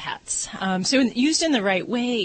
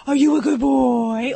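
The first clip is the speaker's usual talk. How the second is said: louder, slow, rising coo; baby talk question; 3 semitones higher, 7 dB louder than usual. Shown as a demonstration. Vet showing staff a baby-talk question to a dog. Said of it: "You're gonna ask".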